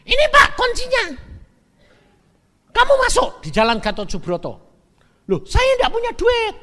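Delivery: wife rattling on to the buyer. Retold or imitated. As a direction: pour the words out without a break.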